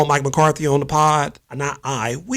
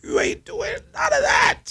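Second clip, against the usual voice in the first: exasperated yell. Real, not acted. Ranting at the team owner.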